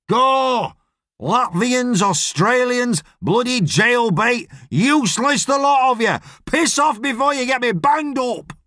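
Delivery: high-pitched angry tirade; exasperated yelling. Acted. Boss throwing out his illegal workers, exasperated yelling. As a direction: yell them out, one group after another. Boss sacks the lot of them.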